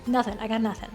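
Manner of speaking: ordinary talk, nothing marked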